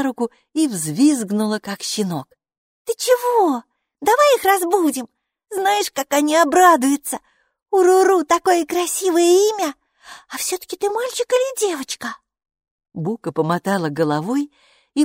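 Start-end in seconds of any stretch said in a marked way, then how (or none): 2.9-12.2 put-on high, bright child's voice, chattering; a cheerful little girl greeting her strange friend; little girl Maiki. Майки, маленькая девочка, радостно болтает с Букой.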